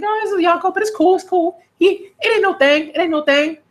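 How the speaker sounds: high, breezy imitation of a carefree barmaid; mock chill Makino.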